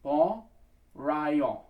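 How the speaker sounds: "Bon, Rayon", the faulty version, each word set apart; incorrect pronunciation. Shown as a demonstration. Choir coach showing singers the wrong version, mispronounced. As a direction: say "Bon Rayon" as two separated loud chunks. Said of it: "which is not correct".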